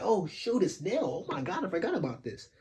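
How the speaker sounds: replayed surprise, starting high; shocked realization. Reader retelling the return of a forgotten character, shocked. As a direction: act out a sudden reminder, then rush the end. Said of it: "So this even shocked me".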